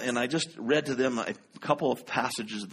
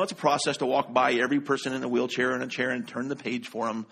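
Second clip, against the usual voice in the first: usual speaking voice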